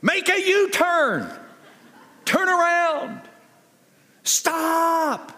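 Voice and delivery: a hammed-up navigator losing patience; bossy GPS lady. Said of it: "what does the British lady say".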